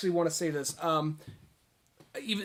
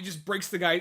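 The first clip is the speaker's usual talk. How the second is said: ordinary talk, nothing marked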